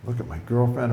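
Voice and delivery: ordinary delivery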